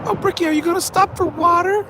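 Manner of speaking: high sing-song taunt from a heckler; high taunting mock voice. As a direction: tease him in a high mocking voice. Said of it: "taunting voice from chat".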